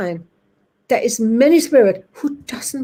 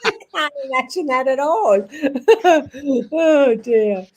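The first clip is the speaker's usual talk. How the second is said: real laughter breaking round the words; higher, tickled protest spilling into a laugh; laughing disbelief; 4 semitones higher than usual. Real, not acted. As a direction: say it while cracking up. Still laughing at the joke.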